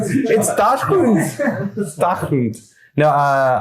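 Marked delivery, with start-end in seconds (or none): 0.0-1.7 high, drawn-out "DAH-shoond" for Dachshund; insisted German pronunciation. Shown as a demonstration. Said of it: "the German pronunciation is Dachshund". 1.8-2.6 higher-pitched "DAHK-hoond" for Dachshund; insisted German pronunciation. Shown as a demonstration. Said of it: "if I'm pronouncing it right".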